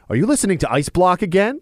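put-on high, innocent little kid voice; a small child gently teasing; kid's voice. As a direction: son asks about swearing on the record, innocent high voice. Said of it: "he looks at me and goes".

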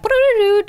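high, loud buzzing raspberry; loud fart noise. Shown as a demonstration. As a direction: blast out a fart sound with the lips. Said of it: "sometimes the boomers will make a sound".

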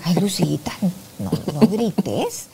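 soft, low mother's plea, drawn out; softly stretched-out reproach, lightly acted; gentle hushing. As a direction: quietly imitate a mother shushing her daughter. Mother hushing her loud daughter.

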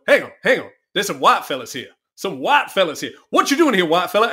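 lightly voiced American comic picking on the front row; comedian on stage. Comedian calling out the audience.

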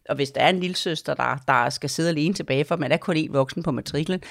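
ordinary talk, nothing marked

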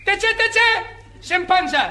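high shouted order to leave the water; lifeguard yelling. Acted. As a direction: bark it across the beach. (צעקת מציל)

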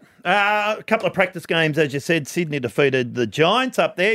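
ordinary talk, nothing marked

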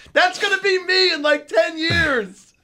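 high-pitched comic shout, stretched at the end; laughing exclamation. Real, not acted. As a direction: cry it out in amused self-mockery. Joking he'll become like his dad.

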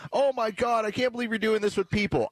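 high, put-on shocked whine; mock outrage. Host mocking critics with high mock outrage. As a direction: pitch it way up like a fake-offended liberal. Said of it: "when they say".

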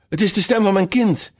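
a high cry of alarm from the queen. A narrator's reading. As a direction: call out high, alarmed for your child. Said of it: "riep de Witte Koningin uit".

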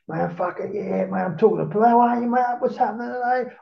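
high-pitched, eager greetings, rising as it goes; re-enacted overfriendly chatter. Freed man talking to bus passengers, overexcited and chummy. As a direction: hail strangers as if thrilled to see them.

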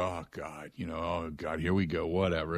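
low, quiet fed-up groan of oh God; exasperated eye-roll. Retold or imitated. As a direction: sigh it out like you've heard it all before. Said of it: "I'm trying not to go".

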